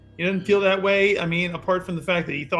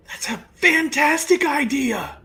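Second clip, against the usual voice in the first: possessed character voice; 8 semitones higher, 4 dB louder, slower than usual. Acted.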